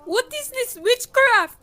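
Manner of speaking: high, slow, alarmed cry; alarmed shout. Acted. Startled by talking book.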